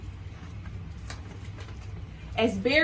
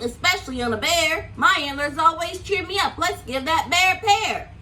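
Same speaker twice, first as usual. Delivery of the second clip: somewhat higher, louder grumble; complaining Moose; 4 semitones higher, 4 dB louder than usual. Acted. Moose complaining to the others about stripes. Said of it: "Moose complained".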